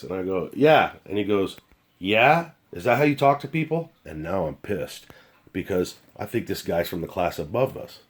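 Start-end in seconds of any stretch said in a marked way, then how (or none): 0.5-0.9 curt yeah. Retold or imitated. Curt reply to stranger. 2.0-3.9 challenging. Retold or imitated. Stranger challenges him, retold quarrel.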